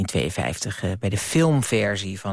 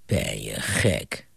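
much lower, slow sewer voice; gruff Willem; 13 semitones lower, 3 dB quieter, much slower than usual. Acted. Willem in the sewer scoffing at Bram, low slow voice. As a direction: growl it slowly from the gutter. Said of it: "zei Willem Balk voor ogen".

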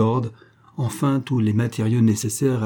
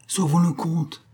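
higher-pitched, understated urgency; urgent appeal; 7 semitones higher than usual. A narrator's reading. Philippe urges saving the count, urgent appeal.

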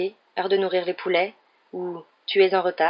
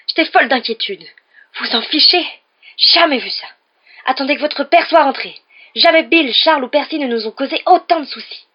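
high, loud, furious scolding; exploding rage; 8 semitones higher, 10 dB louder than usual. A narrator's reading. Mother scolding sons who sneaked off, exploding rage. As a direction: blow up at the boys, shouting each accusation. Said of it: "lorsque sa rage explosa".

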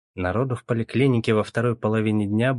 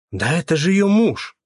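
higher, loud gossiping shout; excited shout; 8 semitones higher, 6 dB louder than usual. A narrator's reading. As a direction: blurt it out to the crowd. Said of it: "загалдела очередь".

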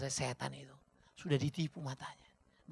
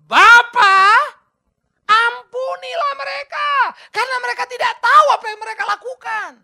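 Jesus crying out; 20 dB louder than usual. Acted. Jesus calling to the Father from the cross, loud and high. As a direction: cry the words out to the Father. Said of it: "Apa yang dia katakan?".

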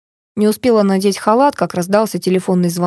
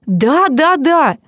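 high, raised, repeated cry; exasperated confirmation; 7 semitones higher, 3 dB louder, much slower than usual. A narrator's reading. Doctor confirms the husband's death, exasperated. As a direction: hammer each yes impatiently.

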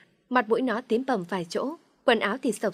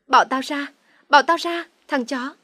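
louder, higher, desperate demand; resisting yell; 5 semitones higher, 7 dB louder than usual. A narrator's reading. Woman pinned by her friend's boyfriend, yelling in resistance. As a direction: fight him off with raised voice. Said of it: "Phượng gào lên chống cự".

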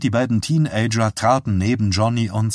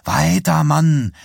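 higher, louder impatient push; urging; 6 semitones higher, 4 dB louder, slower than usual. A narrator's reading. Teenager to Johnny at the wheel, urging. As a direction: press him to go on, raised voice. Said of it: "drängte der Teenager".

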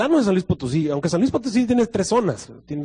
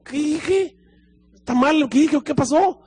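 preguntas agudas y desconcertadas; desconcierto fingido; 10 semitones higher, slower than usual. Retold or imitated. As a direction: imita su propia confusión. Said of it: "yo me quedé así como". (el predicador, confundido ante la risa, pregunta agudo)

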